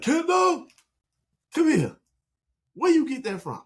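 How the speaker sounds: high, loud imitation of a cross father; booming mock-dad interrogation; angry dad summons. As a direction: call the child out like a furious dad.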